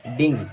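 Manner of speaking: "डेंगी" with a final ee; correct pronunciation. Shown as a demonstration. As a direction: repeat "डेंगी" for learners, ending in ee. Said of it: "बल्कि डेंगी होती है".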